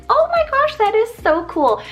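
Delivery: high, loud burst of delight; excited gushing. Retold or imitated. Name lover reacting to learning of a Cyprus grape, excited gushing. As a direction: gush it like an amazed discovery.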